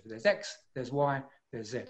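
sure, final, no hesitation; conclusive. Shown as a demonstration. Expert leaving no opportunity to contradict, conclusive. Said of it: "It's very conclusive".

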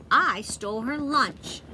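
slow, proud boast; mock proud girl; proudly. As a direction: brag it slowly. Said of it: "Joy said proudly".

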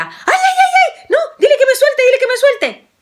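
chillido agudo y fuerte de abuela; abuela chillando. A narrator's reading. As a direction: grita agudo, asustada y riendo. Abuela mordida grita. (la abuela mordida pide ayuda, chillido agudo)

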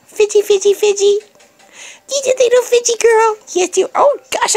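sing-song high coo, fidgy fidgy. Real, not acted. Owner to fidgety puppy, high sing-song baby talk. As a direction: tease the wriggly puppy up high.

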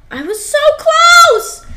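very high, loud, drawn-out wail; frustrated outcry. Real, not acted. Player who just missed the finish, frustrated outcry. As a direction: cry out at a near miss, stretched out.

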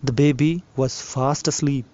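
ordinary delivery, nothing marked

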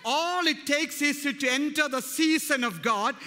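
loud, slowed emphatic proclamation; preacher calling the house to a joyful noise; preaching at full force. Acted. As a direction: hammer each promise home. Builds to call for praise.

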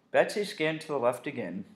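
ordinary delivery

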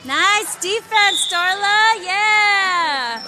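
slow, loud praise shout; cheering. Real, not acted. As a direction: call it out long and loud. Parent cheering at girls' game.

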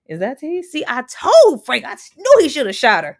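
blurting it out in shock; shocked exclaim. Real, not acted. Reacting to the show, loud shocked exclaim.

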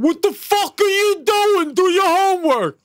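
put-on very high, loud squeaky kid voice; kid cursing. Child yelling at a visitor, squeaky and high. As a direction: squeal it like a tiny bossy kid. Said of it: "my kid going".

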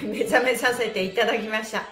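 ordinary talk, nothing marked

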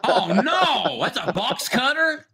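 high-pitched, laughing mock alarm; laughing disbelief. Real, not acted. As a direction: laugh through your disbelief, drawing out the question. Amused alarm at objects on screen.

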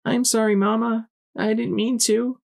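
put-on very high small-child voice; tiny, high, apologetic voice; little child apologizing. A narrator's reading. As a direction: plead like a sorry little filly.

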